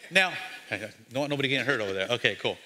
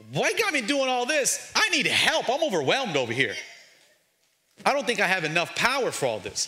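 overwhelmed king; 7 semitones higher than usual. Acted. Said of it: "the king, was like".

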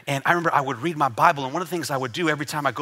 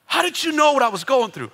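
high, pleading retold question; mildly tearful, raised-pitch imitation; imitated crying caller; 9 semitones higher than usual. Broker asking how the preacher knew, imitated crying. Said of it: "He's crying".

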